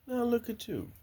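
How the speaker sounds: high, soft fond voice; high, fond coo to the chickens. Real, not acted. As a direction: coo at the grown hens. Talking to grown hens.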